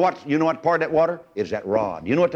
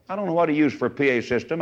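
ordinary talk, nothing marked

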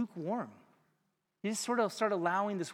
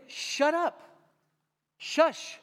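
high, loud "Shut up. Shush."; irritated bystanders silencing the beggar; shushing crowd; 11 semitones higher, 5 dB louder, much slower than usual. Retold or imitated. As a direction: hush him sharply like an annoyed crowd. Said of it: "And everyone went".

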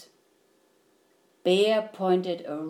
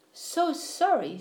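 lightly voiced, higher little mouse; gentle, polite apology; apologetic mouse; 5 semitones higher than usual. A narrator's reading. Mouse apologises to weeping Bear, meekly.

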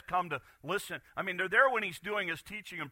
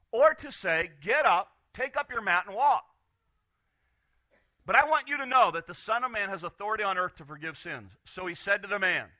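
louder, higher, ringing proclamation; preaching Jesus' words; 5 semitones higher, 6 dB louder than usual. Acted. Preacher voicing Jesus challenging the teachers, ringing out. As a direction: proclaim it to the crowd.

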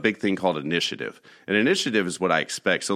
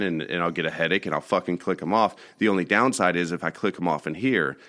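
ordinary talk

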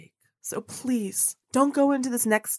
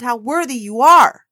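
emphatic; 9 dB louder than usual. Real, not acted. Building affirmation to listener.

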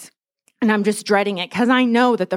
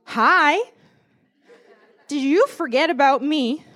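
God checking in; 6 semitones higher, slower than usual. Retold or imitated. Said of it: "it's like God just went".

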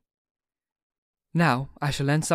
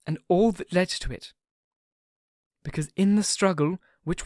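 Mina's voice; 4 semitones higher than usual. A narrator's reading. Mina to Dr Seward about his recording, moved woman's voice. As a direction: speak as a young wife touched by another's grief.

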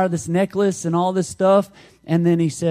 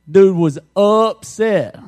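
"upset" stretched slow, loud and higher; drawn-out stress on UPSET; 4 semitones higher, 7 dB louder, slower than usual. Real, not acted. Preacher capping the lost bet story, "upset" loud and drawn out. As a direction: punch "upset" hard and slow.

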